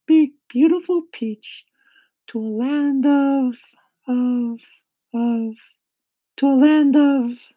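high-pitched, loud character voice; kindly ladybug. A narrator's reading. Ladybug telling James of their departure, high, loud voice. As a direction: speak up high as an eager ladybug.

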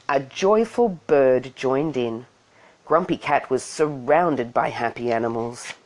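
ordinary delivery, nothing marked

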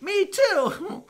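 a hint of an eager old-timer agreeing; old crowd member. Audience of old folks answering the comic, high mock voice. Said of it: "people will be like".

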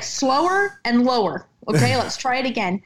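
slow, coaching demo; a producer directing slowly. Retold or imitated. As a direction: coach asking her for a slow, low read. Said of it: "He's like".

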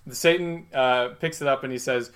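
ordinary delivery

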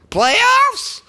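squeaky high incredulous yelp; mock outraged playoffs. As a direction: throw the famous rant line out in disbelief. Mocking the famous coach rant.

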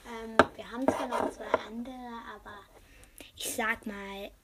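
speaking softly, half whispering; quiet, half whispered. Real, not acted. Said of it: "tut mir leid, wenn ich ein bisschen leise spreche".

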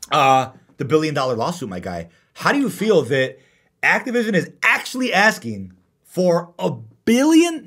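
ordinary talk, nothing marked

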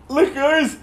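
high, excited cheer; mock joyful winner. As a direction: shout it like you just won big. Lottery winner shouting with joy.

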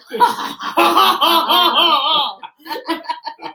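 laughing. Real, not acted.

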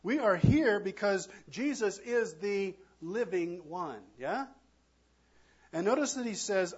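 ordinary delivery

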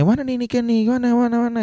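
high, insistent monotone questioning; imitated nagging parent. Narrator mimicking his dad looking for Niken, insistent questioning. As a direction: pester in a put-on high voice.

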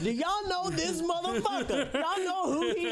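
rowdy laughing shout. Retold or imitated. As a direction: hype the kids up about their dad. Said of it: "I'm like".

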